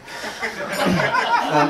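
short burst of laughter. Real, not acted. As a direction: laugh out loud at the joke. Laughs at his own joke.

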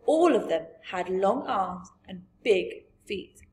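ordinary delivery

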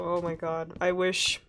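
usual speaking voice

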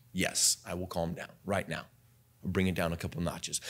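dropped soft and low; a preacher calming down when asked; calmed down. Shown as a demonstration. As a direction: bring it right down a few notches. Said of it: "you're so loud. Can you calm down?".

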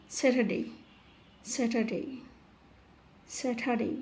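"স্যাটারডে" said with no R before "ডে", like "se-ta-dei"; "স্যাটারডে" repeated singly, R left silent. Shown as a demonstration. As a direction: drill "স্যাটারডে" dropping the R. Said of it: "আর এর উচ্চারণ হবে না".

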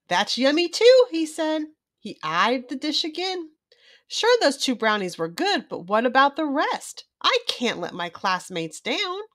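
lively storybook read-aloud; reading to a child. A narrator's reading. Children's book narrator reading aloud to young viewers. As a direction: read it bright and animated for little ones.

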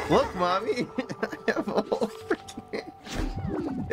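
high, words swallowed by laughter; giddy laughing. Real, not acted. Streamer showing off the conjured cards, giddy laughing.

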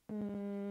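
steady humming buzz demo; bee buzzing hum. Shown as a demonstration. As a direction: hum like a bee on one note. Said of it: "sounds like this".

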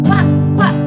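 high, loud quacking like a duck; duck quacks. Pretend. Teacher quacking as the duck in the song. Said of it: "pato is a duck".

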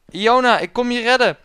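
high, loud call-out; heroic call. Acted. Hero calling to princess Iona, loud and high. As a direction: holler it like a mock rescuer.

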